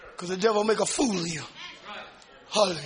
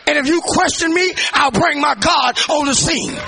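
preaching shout; 7 semitones higher, 7 dB louder than usual. Real, not acted.

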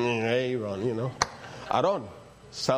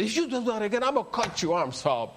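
a touch of mock anger, first words pitched high; half-hearted stern threat to the boys; retold angry threat; 7 semitones higher than usual. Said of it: "I kind of lost it".

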